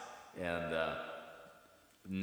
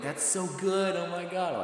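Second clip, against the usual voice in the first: high, louder mock gushing; impressed fans; 11 semitones higher, 5 dB louder than usual. Locals praising his American accent, mock gushing. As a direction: gush in an imitated high fan voice. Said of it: "they're like".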